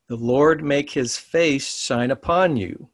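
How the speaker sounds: slow, measured reading with upon drawn out at the end; reading the blessing slowly to the students. Shown as a demonstration. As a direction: lay the blessing down word by word. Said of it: "I'm gonna read it slow".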